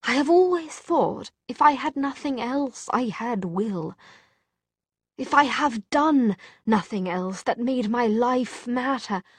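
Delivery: anguished confession. A narrator's reading. Jem tells Tessa he always had Will, anguished. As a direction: pour it out, pitch lifted.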